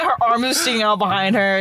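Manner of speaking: ordinary delivery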